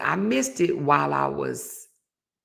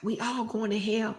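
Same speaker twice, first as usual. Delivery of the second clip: mad colonel; 5 semitones higher than usual. Retold or imitated.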